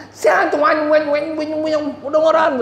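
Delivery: high noisy wen wen babble; imitated loud chatter. Preacher mimics the noisy talk heard down the Siantar train, high babble. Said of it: "bisa kedengeran ke gerbong lima".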